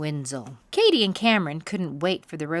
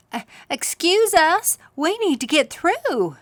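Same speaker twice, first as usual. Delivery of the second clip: high, timid polite request; polite high girl voice; 10 semitones higher, 7 dB louder than usual. Acted. Katie trying to get past the clowns, high and polite. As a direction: ask politely in a small raised voice. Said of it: "no one could hear her".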